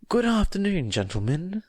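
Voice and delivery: bright, high cheerful hello; cheery greeting. A narrator's reading. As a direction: greet them with sunny cheer. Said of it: "said Nezu cheerily".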